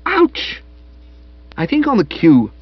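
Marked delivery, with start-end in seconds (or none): 0.1-0.6 high yelp of pain; sharp ouch. A narrator's reading. Madeline yelping as Spode grabs her wrist. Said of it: "the word "ouch" had come through strong and clear".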